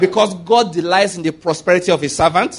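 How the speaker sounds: rally the church to shout back each line; preaching at full force. Acted. Pastor leading the congregation's confession, preaching hard.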